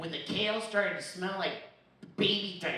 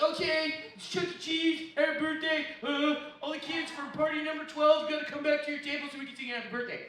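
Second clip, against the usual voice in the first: higher, chipper loudspeaker announcement; announcer voice; 6 semitones higher than usual. Retold or imitated. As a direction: call the kids back like a party host. Chuck E. Cheese loudspeaker announcement.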